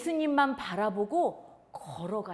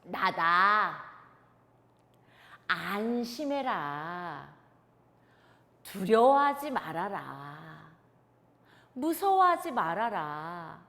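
lower, slow, steady and soothing; calm reassurance; 6 semitones lower, 4 dB louder, slower than usual. Shown as a demonstration. Teacher leading children to repeat Jesus's calm words. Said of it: "다시 한번 전도사님 따라해 볼래요".

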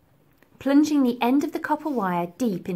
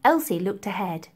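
ordinary delivery

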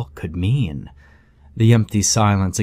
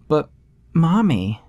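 put-on high little girl voice; child voice; 7 semitones higher than usual. A narrator's reading. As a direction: voice a small child. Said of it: "child voice in story".

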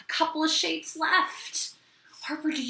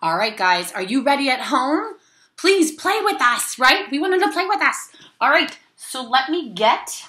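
put-on overbright kids party host; 3 semitones higher, 9 dB louder than usual. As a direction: pump up the kids at home. Kids show host addressing viewers.

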